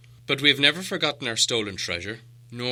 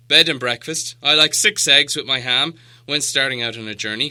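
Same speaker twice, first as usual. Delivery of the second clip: louder, demanding dwarf giving orders; proud dwarf lord; 5 semitones higher, 8 dB louder than usual. A narrator's reading. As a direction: Thorin bossily placing his order. Thorin voiced in story.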